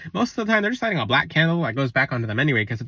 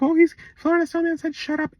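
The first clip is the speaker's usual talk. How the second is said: high mock-shocked voice; scandalized follower; 12 semitones higher than usual. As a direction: gasp it out like a scandalized gossip. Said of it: "And they're like".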